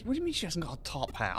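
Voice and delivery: ordinary talk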